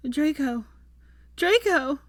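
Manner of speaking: desperate calling. A narrator's reading. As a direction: call the name with mounting panic. Calling to a man not responding.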